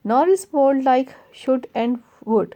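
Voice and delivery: slow, deliberate recitation; measured classroom poem reading. Acted. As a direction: read the verse line slowly for the class. Reading the poem line aloud.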